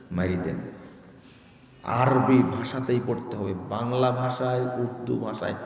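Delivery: usual speaking voice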